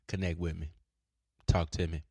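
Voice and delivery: ordinary delivery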